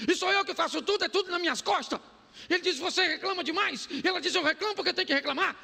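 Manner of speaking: high, fast mock bickering couple; a lightly voiced staged marital argument; staged quarrel. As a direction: ham up the couple's fight. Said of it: "faz parte da encenação".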